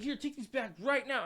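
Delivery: high demand. Retold or imitated. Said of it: "And he's like".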